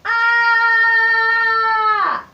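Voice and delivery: loud high shriek of fright; high frightened scream. Acted. Frightened by the spider.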